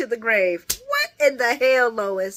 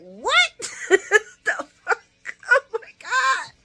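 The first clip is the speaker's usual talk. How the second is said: laughing shock; 12 semitones higher, 3 dB louder, much slower than usual. Retold or imitated. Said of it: "Sonny's like".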